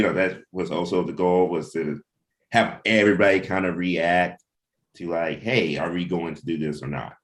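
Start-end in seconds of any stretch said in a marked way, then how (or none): none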